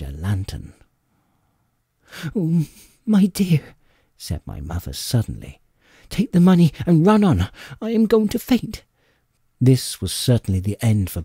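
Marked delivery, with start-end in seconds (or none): 2.3-3.8 high, breathless alarm of a panicking mother; frightened mother. Acted. Mother panics as pursuers approach. 6.1-8.8 high, breathless alarm of a panicking mother; frightened mother. Acted. Mother panics and nearly faints.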